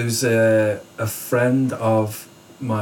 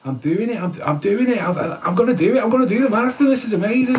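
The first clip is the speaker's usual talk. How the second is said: rising elation; 10 semitones higher, 3 dB louder than usual. Retold or imitated. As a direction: pitch climbing ever higher, louder, into thrilled disbelief. Nearing finish, thoughts retold excitedly.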